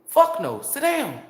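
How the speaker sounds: scornful dismissal. Retold or imitated. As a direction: snap it short and final. Dismissing the complainer.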